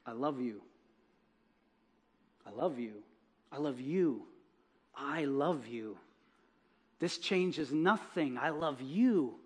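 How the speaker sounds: soft, low, slow loving repetition; tender repeated love. Acted. Man holding her hands, repeating his love softly and slowly. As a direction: say it gently over and over.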